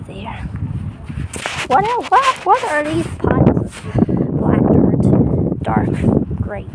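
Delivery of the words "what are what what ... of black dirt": startled question. Real, not acted.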